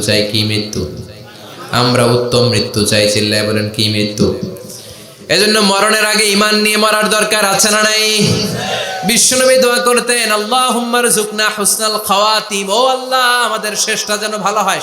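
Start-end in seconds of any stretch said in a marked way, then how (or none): 5.3-14.8 high, sustained sermon chant; impassioned preaching. Acted. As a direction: carry the tale on a high ringing note.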